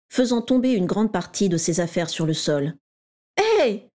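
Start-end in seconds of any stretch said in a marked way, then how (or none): 3.4-3.8 sharp protest. A narrator's reading. Régulus protesting as his things hit the floor, sharp cry. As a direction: yelp it out as the ink breaks. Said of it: "protesta Régulus".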